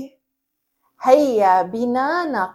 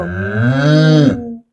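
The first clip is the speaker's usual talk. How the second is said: much lower, loud cow moo; pretend cow moo; 11 semitones lower, 3 dB louder, much slower than usual. Shown as a demonstration. Teacher pretending to be a cow, much lower moo. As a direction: moo deep like a big cow. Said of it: "هيا نتظاهر بأننا بقرة".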